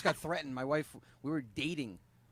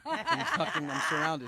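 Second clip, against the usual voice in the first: laughing; 12 semitones higher, 4 dB louder, slower than usual. Real, not acted. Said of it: "I'm gonna agree with everything you women say".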